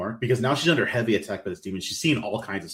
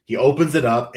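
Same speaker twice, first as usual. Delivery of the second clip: usual speaking voice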